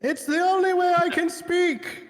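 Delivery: high, slow, booming put-on voice; yelling oracle. The cohost, as the Lord, explains his yelling in a high boom. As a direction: declaim it like a booming god. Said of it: "Why do you keep yelling".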